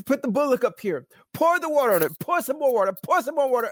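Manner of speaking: high, repeated pulpit orders; urgent, high-pitched imitated commands; preached command. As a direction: call out instructions as the prophet.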